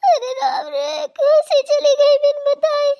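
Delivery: high, loud sobbing wail with a laughing edge; theatrical crying mother; wailing lament. Acted. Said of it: "आपको रोना नहीं है बिल्कुल".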